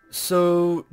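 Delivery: ordinary delivery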